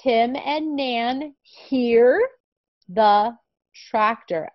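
very slow, clear reading; slow reading aloud. Shown as a demonstration. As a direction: model the sentence word by word. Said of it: "one more time, ready?".

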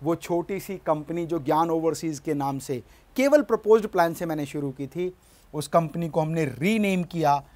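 ordinary talk, nothing marked

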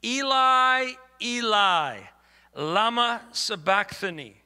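mildly acted crying out in scripture; loud cry. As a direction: cry it out, drawing out each name. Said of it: "cried out with a loud voice".

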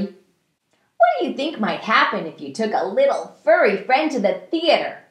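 ordinary delivery, nothing marked

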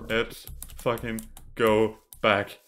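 ordinary delivery

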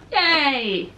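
loud triumphant yay; excited cheer. Real, not acted. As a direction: cheer with relief, high and loud. Finally gets the lipstick out.

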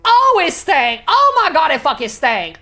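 high, loud, hammed-up disgust; disgusted outcry. Player hit by a stink in the game, loudly disgusted. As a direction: wail it out at the stink.

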